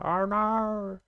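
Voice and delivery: high, drawn-out Australian "Oh, no.". Shown as a demonstration. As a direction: sing it up high like an Aussie. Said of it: "how Australians say, "Oh, no".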